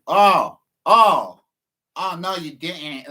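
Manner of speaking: loud, sassy oh no you didn't. Retold or imitated. Man taunting neighbors from the street, sassy. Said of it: "this little bitch part of me set in".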